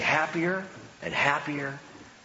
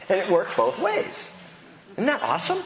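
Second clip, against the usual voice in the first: much higher, thrilled preacher; excited delight; 5 semitones higher than usual. Real, not acted. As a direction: lift it up with real delight.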